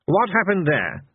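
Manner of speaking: suspicious. A narrator's reading. Fate questioning the mortals, suspicious. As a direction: demand to know, mistrustfully. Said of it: "said Fate suspiciously".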